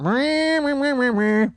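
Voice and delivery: high, pinched nasal bleating. Retold or imitated. Singer filling a three-hour show, mock nasal singing. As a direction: bust out a whiny string of sung notes. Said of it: "eventually you're gonna bust out".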